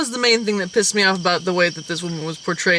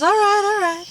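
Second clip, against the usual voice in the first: much higher put-on voice; mock old lady; 12 semitones higher than usual. Old lady answers the realtor in a high chirp.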